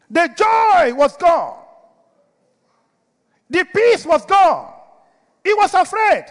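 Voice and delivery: high, loud preacher's cry; full-force pulpit exclamations with long pauses; emphatic preaching. Real, not acted. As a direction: proclaim each loss as a separate ringing shout. Preacher drives home David's loss.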